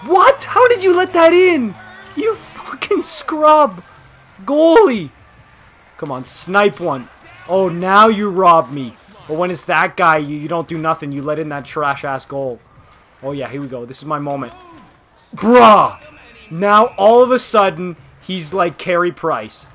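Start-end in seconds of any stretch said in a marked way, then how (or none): 0.1-5.1 much higher, louder angry outburst, cursing the goalie; furious yell. Real, not acted. Raging at own goalie. 15.4-18.0 loud, higher exasperated yell; can't believe the save; exasperated shout. Real, not acted.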